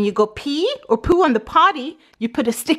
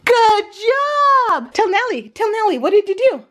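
potty training praise; 8 semitones higher than usual. Real, not acted. Praising toddler.

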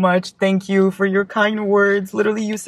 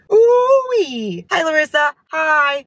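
high, loud delighted whoop; excited squeal; 9 semitones higher, 4 dB louder, much slower than usual. Real, not acted. Book unboxer reacting to a best-friends-to-lovers romance, delighted squeal. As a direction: squeal it like a thrilled fan.

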